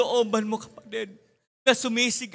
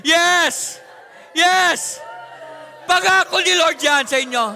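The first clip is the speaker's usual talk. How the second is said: fiery preaching; 3 semitones higher, 6 dB louder than usual. Acted. Pastor imparting the Holy Ghost to the congregation, shouted. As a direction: proclaim it over the crowd with full force. Altar call, preaching at full force.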